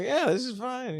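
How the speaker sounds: a high, breezy pretend calm; mock nonchalance. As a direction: pretend it's all fine. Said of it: "and I was like".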